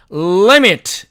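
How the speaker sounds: "limit" with a drawn-out L at the start; held initial L. Shown as a demonstration. Teacher models it for learners, long emphatic L. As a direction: linger on the L of "limit", then release. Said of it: "이렇게 발음한다는 뜻입니다".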